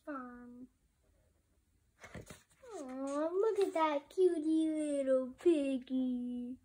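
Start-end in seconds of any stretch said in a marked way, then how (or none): 2.8-6.5 louder, gushing over a cute piglet; excited cooing. Real, not acted. Reader cooing over a piglet picture, gushing. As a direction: coo at the picture like it's adorable.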